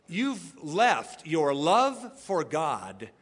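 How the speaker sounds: normal talking voice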